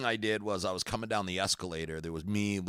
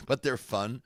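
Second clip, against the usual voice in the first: laughing while speaking; 7 semitones higher than usual. Real, not acted. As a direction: say it through a laugh. Laughing at the joke.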